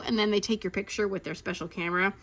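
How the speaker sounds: ordinary talk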